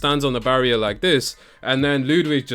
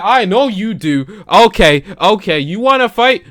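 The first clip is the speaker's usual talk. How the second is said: mock Gunther taunt; 7 semitones higher, 5 dB louder than usual. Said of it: "Gunther was ... like".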